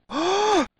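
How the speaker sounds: short, high mock gasp; sudden high intake of breath. Reacting to having failed at something.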